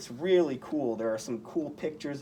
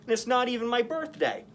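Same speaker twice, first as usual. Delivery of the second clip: higher, quick wisecrack; joking Spider-Man; 9 semitones higher, 4 dB louder, faster than usual. A narrator's reading. Spider-Man teasing the Goblin, quick joking voice. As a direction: toss off the joke fast and bright. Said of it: "Spider-Man joked".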